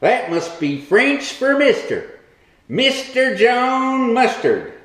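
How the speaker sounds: usual speaking voice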